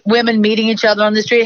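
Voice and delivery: usual speaking voice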